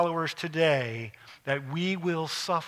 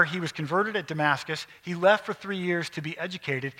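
ordinary talk

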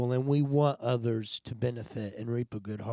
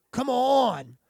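mock pleading; 10 semitones higher, 4 dB louder than usual. Pleading with God, retold.

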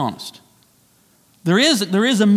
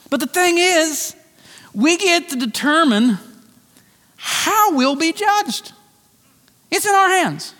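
preaching emphasis; 10 semitones higher than usual. Real, not acted. Preaching climax, higher and slower.

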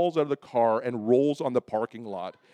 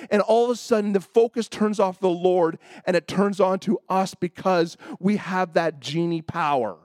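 higher, emphatic pulpit delivery; impassioned preaching; 5 semitones higher, 4 dB louder than usual. Real, not acted. Preacher teaching congregation about faith and persistence, fervent. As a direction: drive each phrase home to the congregation.

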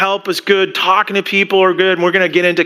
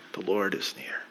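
whispered; 11 semitones lower, 16 dB quieter than usual. Acted. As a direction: whisper it, breathless and intimate. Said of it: "loaded statement".

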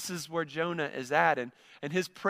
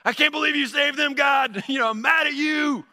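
much higher, louder angry outburst at God; hard metal anger; 8 semitones higher, 5 dB louder than usual. Retold or imitated. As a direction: belt it like a furious metal singer. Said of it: "hard metal".

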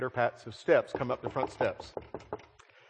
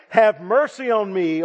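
crying out for mercy; 9 semitones higher, 11 dB louder than usual. Acted. Canaanite woman crying to Jesus.

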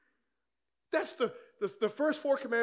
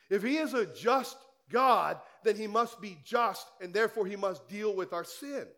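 ordinary delivery

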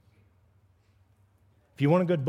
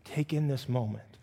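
very slow, soft calming words; soft, drawn-out reassurance; gentle slow down; 2 semitones lower, 4 dB quieter, slower than usual. Retold or imitated. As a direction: ease them down like calming a rushing friend. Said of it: "Jesus is gonna go".

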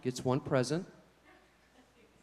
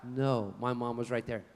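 ordinary talk

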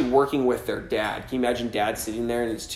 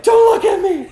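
very high, loud comic shriek; mock panicked squeal; 10 dB louder than usual. Preacher playing himself hiding from God, mock panicked squeal. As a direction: squeal it out in hammed-up shame.

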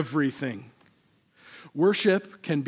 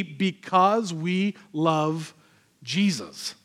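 slow sincere answer; much slower than usual. Acted. Slowed emphatic answer.

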